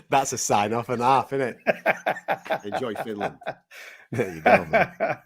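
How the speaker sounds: talking through laughter; real laughter. Real, not acted. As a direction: keep laughing as you get the words out. Still laughing at the sign-off.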